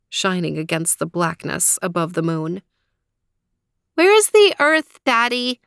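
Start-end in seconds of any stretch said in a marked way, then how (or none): 3.9-5.6 put-on high, loud child's question; worried little girl. A narrator's reading. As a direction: ask it like an anxious kid. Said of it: "she asked".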